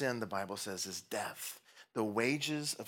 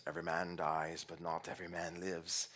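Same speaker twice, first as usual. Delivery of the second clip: low, soft attempt at a Scottish accent; 6 semitones lower, 7 dB quieter than usual. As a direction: quote it in a half-hearted Scottish accent. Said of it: "the best Scottish accent I got".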